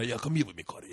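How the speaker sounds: put-on low, soft foreign-accented invitation; Rudy. Janitor asks student to visit, soft foreign accent. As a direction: imitate a janitor's accent, voice dropped. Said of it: "Rudy the janitor is talking about".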